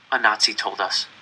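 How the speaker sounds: ordinary delivery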